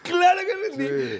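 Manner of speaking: loud, high laughing boast. Real, not acted. [name] tells the host he passed, laughing. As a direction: laugh out the proud answer.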